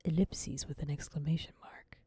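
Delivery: hushed unvoiced whisper. Real, not acted. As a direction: breathe the words out, no voice. Reading reaction text.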